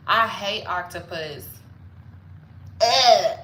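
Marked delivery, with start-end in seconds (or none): 2.8-3.4 loud, raised groan of revulsion; disgusted ugh. Pretend. Hating on octopus, disgusted groan. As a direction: groan "ugh" loudly at something revolting.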